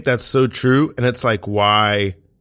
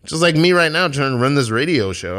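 ordinary delivery

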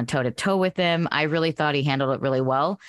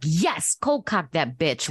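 ordinary delivery

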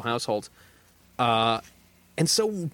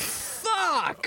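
high-pitched drawn-out cry of dismay; exasperated outburst. Real, not acted. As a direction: wail the curse in comic despair. Learns six albums await him.

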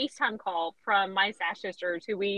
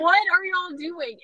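higher, louder confused question; mock surprise; 7 semitones higher, 5 dB louder than usual. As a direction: act baffled at the screaming friends. Retold answering friends' call.

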